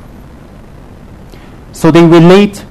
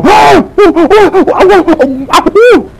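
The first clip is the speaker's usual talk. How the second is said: mock horrified auntie; 13 semitones higher, slower than usual. Aunties in Ipoh hearing a lama eats meat, mock horror. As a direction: hoot "oh" repeatedly like a scandalised auntie. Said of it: "aunties in, like, Ipoh, they go".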